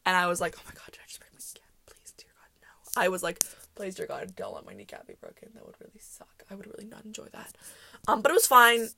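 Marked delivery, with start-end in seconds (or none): 0.6-3.0 fast breathless whisper of panic; frantic whisper. Retold or imitated. Panicked prayer after being hit. 3.7-7.5 hushed pleading whisper; whispered prayer. Retold or imitated.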